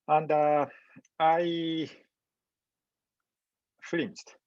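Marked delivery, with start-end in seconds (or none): none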